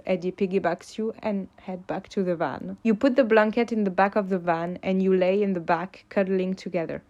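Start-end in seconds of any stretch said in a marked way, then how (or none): none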